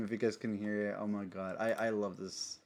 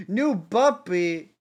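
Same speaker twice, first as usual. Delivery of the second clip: slow, loud, high squeal of delight; excited; 6 semitones higher, 8 dB louder, much slower than usual. Real, not acted. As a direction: stretch it out, thrilled. Excited about the puppy.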